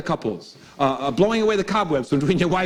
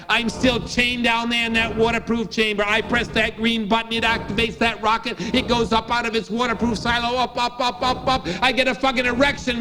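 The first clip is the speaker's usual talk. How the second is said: high, building to a frantic cheer; a coked-up sailor raving higher and higher; manic sailor; 9 semitones higher than usual. Retold or imitated. Jack raving about firing his rocket, manic and high. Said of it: "And he goes".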